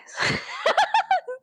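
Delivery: high, shrieking burst of laughter. Real, not acted. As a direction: break into a squealing laugh.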